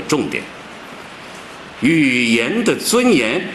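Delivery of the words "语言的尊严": slow, drawn-out reading of 语言的尊严; stress demo. Shown as a demonstration. Lecturer slowly reads the title to ask where the stress falls, drawn out. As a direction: read 语言的尊严 very slowly, weighing each word. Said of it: "重音在什么地方？".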